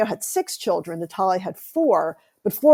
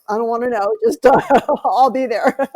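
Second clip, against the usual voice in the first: playful mock reply broken by a laugh, louder; 6 semitones higher, 5 dB louder than usual. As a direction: laugh while brushing off the news. Joking about the backyard chicken dinner.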